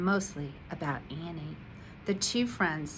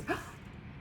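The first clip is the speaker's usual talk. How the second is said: hushed breathless gasp; soft astonished gasp; 10 dB quieter than usual. A narrator's reading. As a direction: catch your breath in wonder. Mouse sees Willie changed.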